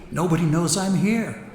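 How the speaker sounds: ordinary talk, nothing marked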